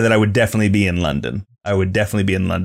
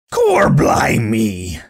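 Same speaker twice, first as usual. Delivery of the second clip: very slow, drawn-out mock Cockney that slips into pirate; piratey Cockney exclamation, milked slowly; attempted Cockney accent; much slower than usual. Shown as a demonstration. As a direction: ham up a bad Cockney, stretching each word. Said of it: "really use my Cockney accent".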